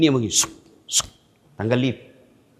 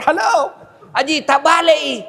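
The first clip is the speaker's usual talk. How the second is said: calling out; 10 semitones higher, 4 dB louder than usual. Retold or imitated. Preacher calling to haji.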